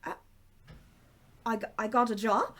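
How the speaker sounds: a hint of Irish, surprised question; lightly Irish, can't believe it; Irish accent. Darlene hears she has a job, surprised, in light Irish. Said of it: "She has an Irish accent".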